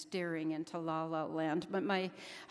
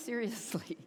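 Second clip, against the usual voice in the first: ordinary talk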